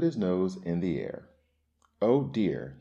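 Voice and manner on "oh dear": mild storybook sneer; louder, disdainful sigh of words. A narrator's reading. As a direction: say it with a touch of snobbish scorn. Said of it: "he sneered".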